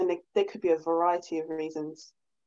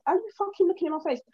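indignant; 9 semitones higher, much faster than usual. Retold or imitated. Retelling her retort to men.

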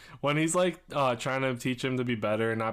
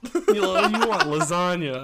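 laughing quote; 5 semitones higher, 8 dB louder than usual. Retold or imitated. Quoting movie, laughing.

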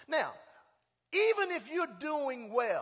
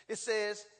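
normal talking voice

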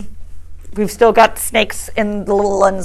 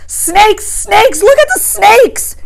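much higher, louder frightened cry; terrified scream; 13 semitones higher, 4 dB louder, much slower than usual. A narrator's reading. Little boy screaming at the boys, terrified. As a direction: scream in terror at the fire. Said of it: "The little boy screamed at them".

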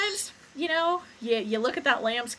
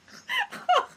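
very high little squeak; imitated fan squeak. Fan reenacts squeaking at the actor on screen, high squeak. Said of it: "apparently I kept squeaking".

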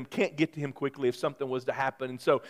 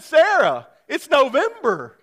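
exasperated husband; 10 semitones higher, 9 dB louder than usual. Retold or imitated. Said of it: "I said".

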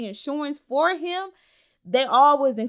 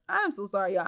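ordinary talk

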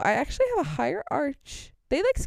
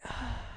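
long, soft acted sigh; weary sigh; 14 dB quieter than usual. Customer unhappy with the shoes offered, weary sigh. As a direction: let out a drawn breath. Reaction while trying shoes.

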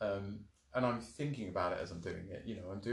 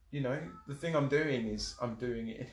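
normal talking voice

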